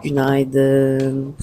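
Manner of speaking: hoarse morning voice, just woke up. Real, not acted. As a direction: voice still rough from sleep. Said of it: "Hala sesim açılmadı". (yeni uyanmış kısık sabah sesi)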